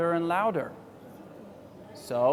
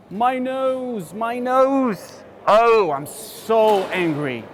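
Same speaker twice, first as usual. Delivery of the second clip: higher, louder hurt bear howling; growling in pain; 4 semitones higher, 5 dB louder, slower than usual. Acted. Teacher reading the stung bear's angry cry. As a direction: wail about the stung nose, then growl. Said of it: "growls Mister Bear".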